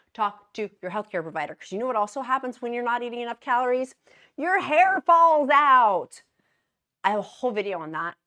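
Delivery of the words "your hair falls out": slow, loud, higher dramatic reveal; dramatic punchline. Acted. Warning viewers, slow loud dramatic reveal. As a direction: drag each word out for shock.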